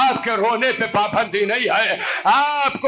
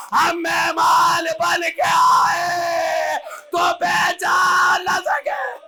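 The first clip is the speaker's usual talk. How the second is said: climactic preaching; 7 semitones higher than usual. Acted. Preacher voices Zainab revealing herself to Shiri, wailing. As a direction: push the grief to its peak.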